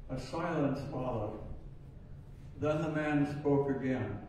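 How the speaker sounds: ordinary talk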